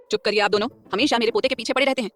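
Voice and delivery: scolding grandmother. A narrator's reading. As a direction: hush the whole table like a stern elder. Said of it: "order देते हुए बोली".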